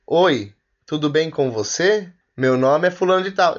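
slow, carefully articulated phrasebook voice; a lightly mocked textbook speaker; textbook English speaker. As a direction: spell it out like a language tape. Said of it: "o inglês fala assim".

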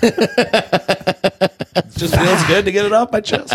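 high, slow, breaking into laughter; laughing. Real, not acted. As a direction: giggle through the line. Laughing after fake coming out.

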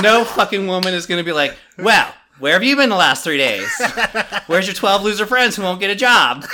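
loud, high indignant yelling; imitating a fed-up nagging woman; ranting comic impression. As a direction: yell it like an outraged stand-up bit. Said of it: "he had that joke. He's like".

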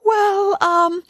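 very high, stalling "well, um"; evasive hesitation. Acted. Puppet avoids confessing to the fairy, evasive hesitation. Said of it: "didn't like to admit what he had done".

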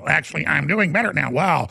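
flat mechanical robot voice. Retold or imitated. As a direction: talk like a robot. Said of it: "he starts talking like a robot".

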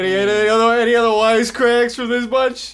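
loud, fast scolding of the crowd; hammed-up host exasperation; mock host scolding. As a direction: call the rowdy bunch to order.

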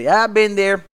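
loud, high quoted reply; punchy imitation of a buddy; imitated friend. Quotes his barber friend.

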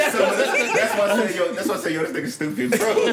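high, excited talk through real laughter; laughing hard. Real, not acted. Co-host calling a friend stupid, cracking up. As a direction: crack up while agreeing.

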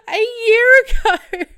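very high, loud laughing repeat; real laughing shriek; laughing cry. Real, not acted. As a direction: break into laughing disbelief.

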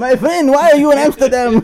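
high, hammed-up Dutch accent; squeaky mock Amsterdam local, Dutch accent. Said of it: "do not question my accent".

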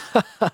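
high giggling laugh; burst of laughter. Real, not acted. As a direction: laugh out suddenly. Laughs at the robbery.

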